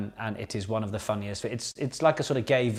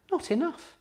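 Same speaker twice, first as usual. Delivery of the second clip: mock high voice. Host answers gin question comically.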